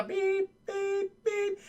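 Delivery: high, thin electronic beeps; imitated bomb beeps. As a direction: beep like a countdown device. Imitates the bomb's beeping.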